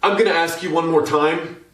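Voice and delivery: loud, high final warning; yelled warning. Acted. PR lady demanding the cyclist's camera, yelled warning. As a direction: yell it over the racket as an ultimatum. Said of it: "She has to yell it".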